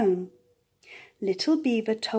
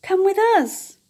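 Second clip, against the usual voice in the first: high, bright come with me; eager invitation; 10 semitones higher, 6 dB louder than usual. A narrator's reading. Little beaver happily inviting the duck along. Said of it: "said Little Beaver and the duck".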